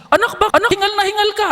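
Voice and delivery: high, anxious mother's voice on the phone; worried mother. Retold or imitated. Mother asking her soldier son why he's panting, high and worried. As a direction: sound like a mom fretting over her son.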